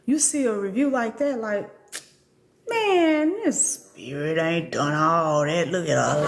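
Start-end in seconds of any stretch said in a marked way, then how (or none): 2.7-6.3 low, slow mocking spirit voice. A spirit scoffing at the reviewer, low and slow. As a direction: drop low and slow to tease.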